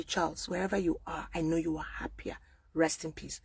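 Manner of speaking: soft, hushed goodbye to the dead; tender farewell. Real, not acted. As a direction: say it softly as a farewell. Tender farewell to late uncle.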